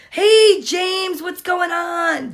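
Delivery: loud, bright call across the room; greeting a viewer by name with a loud call. Real, not acted. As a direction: shout a cheery hello to James.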